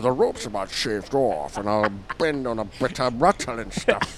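put-on slushy Scottish accent, s's turned to sh; Sean Connery. Retrying the sailor's line, mock Scottish film-star accent. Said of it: "Sean Connery is, uh, the Scotsman".

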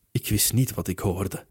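plain reading voice

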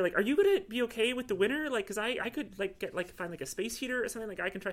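high-pitched, halting offer; anxious concern. Acted. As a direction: stumble through a worried offer. Woman anxiously offers cat a heater.

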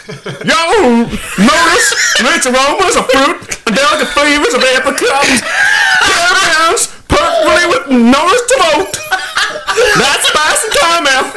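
mock Kentucky bourbon ad read, cracking up; Kentucky accent. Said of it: "You should be reading it in a Kentucky accent".

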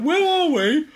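high-pitched mock-lost cry; mock bewilderment. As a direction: squeal it as if hopelessly lost. Retelling confusion on motorway drive.

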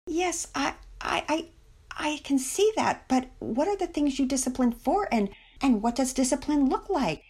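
high, halting, stammered questions; desperate. A narrator's reading. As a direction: stumble in, then plead in a high voice. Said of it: "Missus Belmont seemed desperate".

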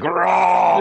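loud high mock roar; dinosaur voice. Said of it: "he hears a low growl and he's like".

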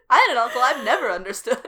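giggling through the words; laughing. Real, not acted. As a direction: laugh while you say it. Laughing while repeating line.

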